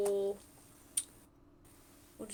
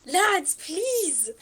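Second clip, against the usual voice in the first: higher, louder desperate plea; 7 semitones higher, 7 dB louder than usual. Real, not acted. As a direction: beg the table out loud. Begging the players.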